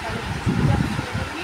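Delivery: whispered instruction. Real, not acted. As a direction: hush him off to sleep. Telling son to sleep.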